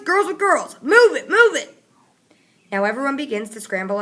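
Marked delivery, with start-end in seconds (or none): 0.0-1.8 loud, high soldier's shout; barked order. A narrator's reading. Soldier herding kids, shouting loud and high.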